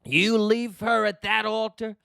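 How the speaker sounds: high, emphatic plea to a groom; mock urging. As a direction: urge him as if shouting advice at the TV. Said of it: "And I'm saying, "Nick".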